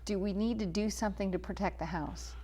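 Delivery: normal talking voice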